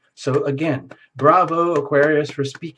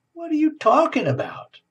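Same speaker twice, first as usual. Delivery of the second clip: a much higher, baffled squeak of a question; 13 semitones higher than usual. Retold or imitated. Aquarius asking the happy people, baffled and high-pitched. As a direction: pitch it up in total bewilderment.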